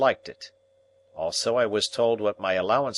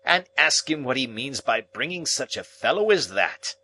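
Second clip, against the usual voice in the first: disgusted; 5 semitones higher than usual. Acted. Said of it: "in extreme disgust".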